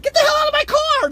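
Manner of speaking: very high, loud shout; sudden high-pitched panicked yell; alarmed yelling. Retold or imitated. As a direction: holler at a kid to get out. Retells yelling at kid in car.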